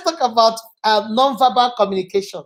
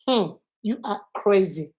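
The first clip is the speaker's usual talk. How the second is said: hum, then a softer, measured verdict; serious judgment; 3 semitones lower, 6 dB quieter than usual. Shown as a demonstration. Teacher showing the same words meant seriously, disapproving. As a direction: drop the playfulness and judge them quietly. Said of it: "the same thing I said".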